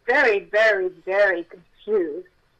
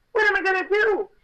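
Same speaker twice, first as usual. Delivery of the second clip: desperate cry; 11 semitones higher, 4 dB louder than usual. Real, not acted. Despair over career as actress.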